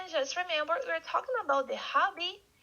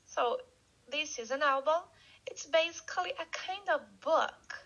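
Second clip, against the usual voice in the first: ordinary talk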